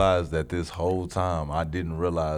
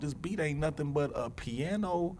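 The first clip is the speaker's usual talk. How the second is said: much higher and excited; 8 semitones higher than usual. Real, not acted. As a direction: get worked up listing it. Stripping down the beat.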